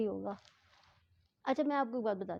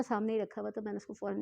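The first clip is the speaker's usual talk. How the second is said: ordinary talk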